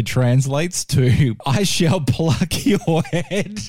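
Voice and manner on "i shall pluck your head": slow, giggling through the words; can't hold back the laughter while quoting; laughing through it. Real, not acted. Host repeating the gruesome chorus line while laughing.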